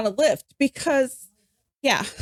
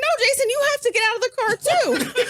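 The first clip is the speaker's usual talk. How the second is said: higher, laughing mock scold; laughing exasperation; 10 semitones higher than usual. Host telling drunk friend to get out, laughing higher. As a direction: scold him while cracking up. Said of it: "I'm like".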